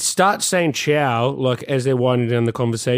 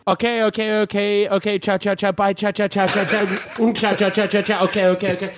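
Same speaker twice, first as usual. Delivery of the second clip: higher, rapid fading ciaos; mock Italian phone goodbye; 5 semitones higher than usual. Mocking Italians ending phone calls, higher rapid goodbyes. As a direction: wind down like a caller drifting off. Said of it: "Slowly getting the microphone away".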